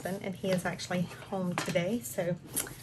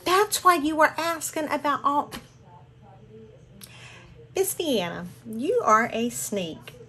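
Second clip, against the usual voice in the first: higher and louder, delighted realization; excited; 4 semitones higher, 7 dB louder than usual. Real, not acted. Catching Miss Deanna's secret, delighted and loud. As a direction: call her out playfully.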